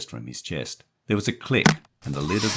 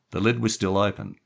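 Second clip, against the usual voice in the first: ordinary delivery, nothing marked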